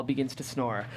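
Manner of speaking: ordinary talk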